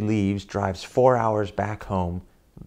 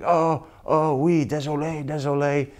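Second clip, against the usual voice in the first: apologetic Frenchman; 6 semitones higher than usual. Retold or imitated.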